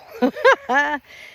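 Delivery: short burst of laughter; laughing. Real, not acted. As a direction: laugh out loud. Laughs at own joke.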